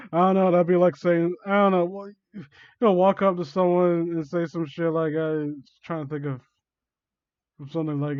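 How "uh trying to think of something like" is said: ordinary delivery, nothing marked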